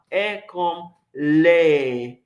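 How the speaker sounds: "air comme l'air" stretched slowly, open vowel held; careful slow teacher's demo of "air comme l'air"; slow model pronunciation. Shown as a demonstration. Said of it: "Répétez après moi".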